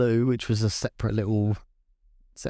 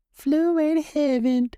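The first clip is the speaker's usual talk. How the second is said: mock angelic; 3 dB louder, slower than usual. Mock angelic ending for dead hamster.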